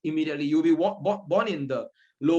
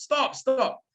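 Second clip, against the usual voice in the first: mock shout; 8 semitones higher than usual. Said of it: "Angulimala shouts".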